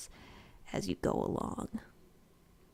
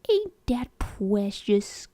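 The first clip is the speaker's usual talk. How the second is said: mock cooing; 3 semitones higher, 6 dB louder, slower than usual. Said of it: "this character can go wrong is the".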